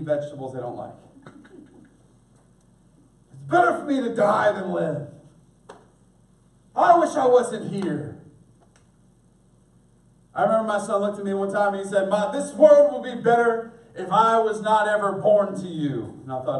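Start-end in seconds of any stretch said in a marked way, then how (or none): 3.3-8.2 loud mock tantrum; hammed-up childish wail of complaint; complaining kid. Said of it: "exactly the response that my kids give". 12.1-16.2 loud, melodramatic mock lament; hammed-up declaration of misery to mother; Shakespearean son. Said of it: "a little Shakespearean there".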